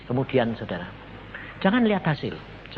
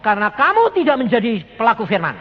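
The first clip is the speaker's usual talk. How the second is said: louder, higher proclamation; loud declaration; 7 semitones higher, 8 dB louder than usual. Retold or imitated. Preacher loudly repeating God's word to the husband. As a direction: proclaim it to the woman. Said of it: "Saya cuma ngomong".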